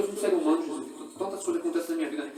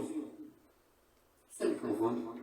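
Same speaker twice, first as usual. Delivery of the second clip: slow hushed question; 6 semitones lower, 12 dB quieter, much slower than usual. Acted. Asking God softly, slowly.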